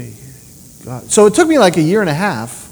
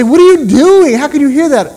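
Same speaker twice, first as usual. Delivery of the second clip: puzzled wife; 8 semitones higher, 6 dB louder than usual. Retold or imitated.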